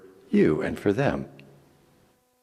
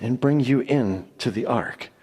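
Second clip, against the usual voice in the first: ordinary delivery, nothing marked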